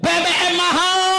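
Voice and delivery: high, drawn-out crowd cry; protesters' shout. Acted. People protest fuel prices to officials, high shout. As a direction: cry it out slowly as a street protest. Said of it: "Rakyat teriak".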